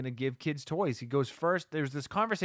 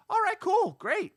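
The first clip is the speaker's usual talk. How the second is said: much higher, sing-song mock cheer; fake-chipper all right cool; mock relief; 4 dB louder than usual. Viewer at the hospital scene thinks all is fine, mock-cheerful and high.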